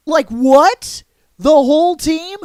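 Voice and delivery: high, loud questions swooping upward; incredulous questioning. Real, not acted. Host stunned by the team's wipeout, incredulous questioning. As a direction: ask it in loud disbelief, pitch climbing. Disbelief at losing whole team.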